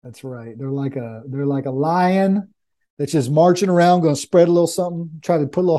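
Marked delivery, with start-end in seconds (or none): 0.0-0.6 right in your ear. Real, not acted. Agreeing very softly near the mic.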